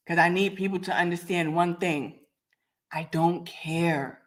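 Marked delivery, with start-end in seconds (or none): 2.9-4.2 each word dragged out slowly; slow emphatic. Real, not acted. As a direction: lay it down slow and final. Defiant rant to critics.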